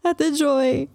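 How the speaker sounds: choked, tearful call to her sister; sobbing. A narrator's reading. Narrator calling her sister, sobbing. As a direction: barely get the word out through tears. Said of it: "My sobs were so thick".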